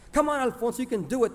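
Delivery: high, encouraging cheerleader call; cheerleader cheering. A narrator's reading. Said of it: "They shouted like cheerleaders".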